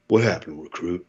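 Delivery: low, level, gritty calm, a hint of Eastwood; Clint Eastwood voice. Drill instructor questions the recruit, lightly voiced Eastwood calm. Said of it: "like a Clint Eastwood voice".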